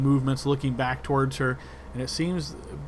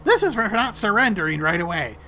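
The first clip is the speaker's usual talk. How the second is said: higher, louder taunt; spiteful rat bite line; 6 semitones higher, 5 dB louder than usual. Acted. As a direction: bite the words out. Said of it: "she says".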